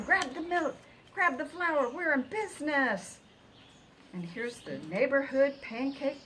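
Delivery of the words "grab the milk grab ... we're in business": high, loud, brisk commands; rally everyone into action; excited orders. A narrator's reading. Ruby flapping into action, ordering.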